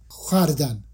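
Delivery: خردن said as compact "khʷardan"; no separate "o" in خردن, rounded kh then "a"; old pronunciation. Shown as a demonstration. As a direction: demonstrate old-style "khʷar-dan" for خردن. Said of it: "اگر با تلفظ قدیم بخوایم تلفظ کنیم".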